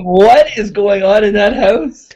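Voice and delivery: loud, drawn-out imitation of alarmed neighbors; hammed-up baffled outcry; mock freaking out. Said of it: "probably just fucking freaking out".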